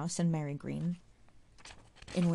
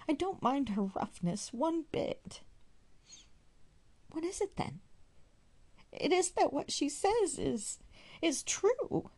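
tearful; 7 semitones higher than usual. A narrator's reading. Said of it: "found her crying in the outhouse".

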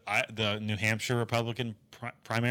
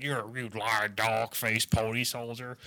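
softer, lower put-on Biden voice; Biden impression; 3 semitones lower, 3 dB quieter than usual. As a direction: growl it like an old man scolding. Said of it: "he's like".

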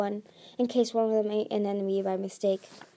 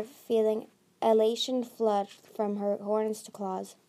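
ordinary delivery

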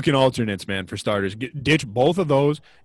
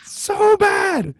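exasperated cry; much slower than usual. Real, not acted. Podcast host reacting to Vegas jerseys, high appalled shout. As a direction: cry it out much higher, appalled.

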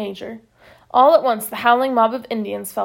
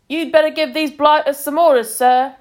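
higher, louder shout over the noise; shouting over uproar; 4 semitones higher, 6 dB louder than usual. A narrator's reading. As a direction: yell to be heard above a crowd. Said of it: "he shouted at Patrick above the uproar".

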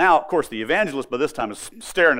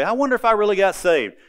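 doubting self; 9 semitones higher, 6 dB louder than usual. Retold or imitated. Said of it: "look back and say".

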